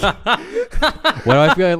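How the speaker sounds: slow, laughing start to a thought. Real, not acted. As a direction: speak through a laugh. Laughing while starting theory.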